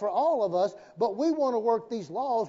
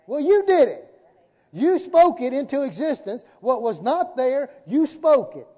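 raised, emphatic pulpit delivery; forceful preaching; 4 semitones higher, 6 dB louder than usual. Real, not acted. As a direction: preach it louder, hammering the point. Preacher drives point home forcefully.

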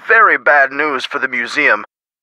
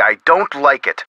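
ordinary talk

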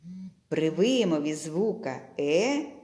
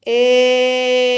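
calling out; 5 semitones higher, 10 dB louder than usual. Shown as a demonstration. Teacher calls the word aloud for the pupils to hear the stressed syllable. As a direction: call the word out loud and high. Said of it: "Гукаємо".

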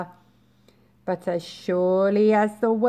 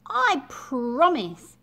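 earnest promise; 4 semitones higher, slower than usual. Acted. Tadpole makes solemn promise.

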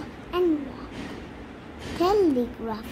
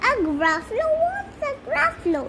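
rising to a high-pitched, puzzled query; puzzled question; 10 semitones higher, faster than usual. Acted. Snake asks mouse about the unknown creature, puzzled. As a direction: ask it in high-voiced bewilderment.

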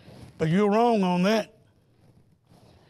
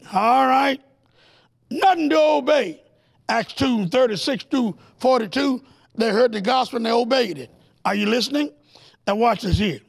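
preaching at full force, higher and driving; fiery preaching; 4 semitones higher, 3 dB louder than usual. Acted. Preacher warning the congregation against snake talk, fiery preaching. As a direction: preach it to the congregation, building fire.

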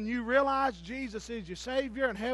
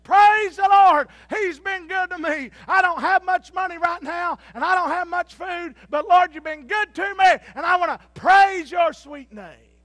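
shouted praise; 9 semitones higher, 16 dB louder than usual. Acted. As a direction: lift your voice to heaven. Lady praising aloud on porch.

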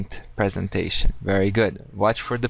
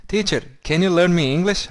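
mimicking a student; 7 semitones higher than usual. Teacher imitating a student asking him, high and eager. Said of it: "I hear this one".

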